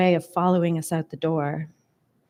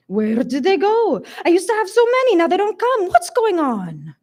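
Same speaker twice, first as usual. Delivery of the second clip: put-on thick Hungarian accent; 11 semitones higher, 4 dB louder than usual. A narrator's reading. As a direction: wail in distress with a heavy Hungarian accent. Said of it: "Hungarian accent".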